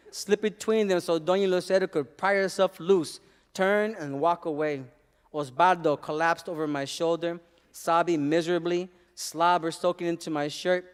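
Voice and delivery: plain reading voice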